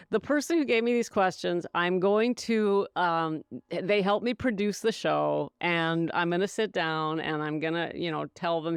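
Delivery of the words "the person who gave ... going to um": ordinary delivery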